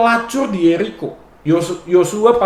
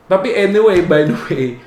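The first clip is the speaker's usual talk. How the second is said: ordinary delivery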